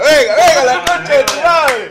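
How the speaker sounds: high, loud mock shout. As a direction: yell the insult playfully. Shouting insult with accent.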